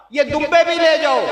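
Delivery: loud, high offer; excited shout. Acted. Ibrahim urges the men to take his rams, a loud shout. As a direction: shout it out, giving everything away.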